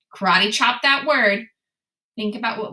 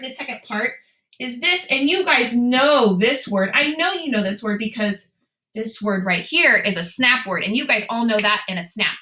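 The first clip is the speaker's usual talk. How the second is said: ordinary delivery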